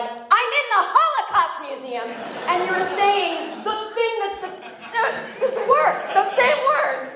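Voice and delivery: exasperated retold protest, voice climbing very high; incredulous outburst. Retelling her outraged reply to him.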